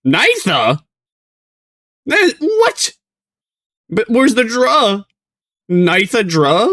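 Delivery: high, squeaky questioning; baffled disbelief. Real, not acted. Streamer puzzled where the dra went, high baffled squeak. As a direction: pitch way up, utterly confused.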